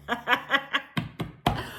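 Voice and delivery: breathy run of laughter; cracking up. Real, not acted. Host amused by Lisa's denial, cracking up. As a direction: laugh helplessly at your own impression.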